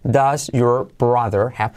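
"does your brother have" slow, word by word; careful textbook "does your brother have"; each word of "does your brother have" apart; separated words. Shown as a demonstration. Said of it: "así, separado".

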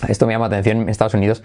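normal talking voice

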